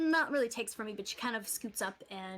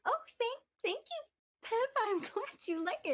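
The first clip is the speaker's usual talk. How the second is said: high, giggly thanks; shy girl caught off guard; flustered, blushing; 10 semitones higher than usual. Acted. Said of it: "she blushes a little bit and goes".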